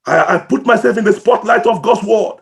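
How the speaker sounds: ordinary delivery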